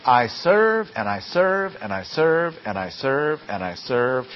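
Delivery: "I serve" repeated rhythmically, stepping down in pitch each time; ongoing repetition. Shown as a demonstration. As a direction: chain each "I serve" as endless action. How often does this action go on.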